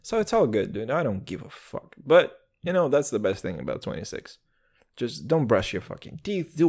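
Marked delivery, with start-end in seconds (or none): none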